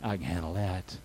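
very low, soft, unbothered voice; God's calm answer. Retold or imitated. God responds to problems that seem so big, calmly. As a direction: drop deep and quiet, completely untroubled. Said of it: "Isn't that calm?".

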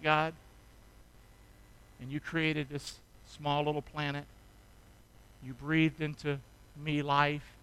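soft, low, close-up sermon voice; tender hushed preacher, speaking gently to the congregation; hushed intimate preaching. Acted. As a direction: draw the listeners in quietly. Soft intimate reflective passage.

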